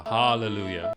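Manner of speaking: hallelujah with a dark round ah; darker ah. Shown as a demonstration. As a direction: open hallelujah on a deep ah vowel. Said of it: "we'll do a darker ah".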